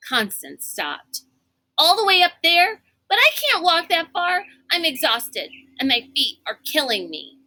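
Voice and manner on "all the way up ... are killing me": whining. A narrator's reading. Constance whining, exhausted.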